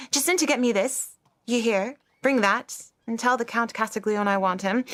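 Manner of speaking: loud, high, commanding lady's voice; imperious lady. Retold or imitated. Maid mimicking her mistress ordering her about, loud and high. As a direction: bark orders like a grand mistress. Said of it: "So loud, so ladylike, and so commanding".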